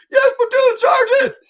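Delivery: very high, very loud panicked-recruit squeal; mock recruit's shouted response. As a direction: scream the reply at attention, exaggerated. Recruit answers the yelling sergeant.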